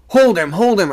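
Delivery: high, loud urgent shout. A narrator's reading. Sailor shouting to the crew to hold the boy, desperate and loud. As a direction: yell for help with all you've got. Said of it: "I shouted".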